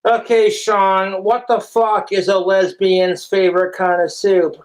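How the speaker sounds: normal talking voice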